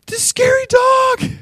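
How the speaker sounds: put-on very high, loud, slow little-boy cry; scared child. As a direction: shriek it like a frightened kid. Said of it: "he's scared because".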